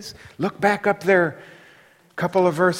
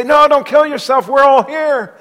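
loud, high call to stop him; urgent call; 9 semitones higher, 9 dB louder than usual. Acted. Paul calls to the jailer, loud and high. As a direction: shout to keep him from the sword. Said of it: "and they say".

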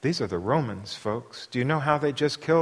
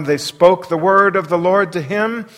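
normal talking voice

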